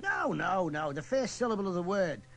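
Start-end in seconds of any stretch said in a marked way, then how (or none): none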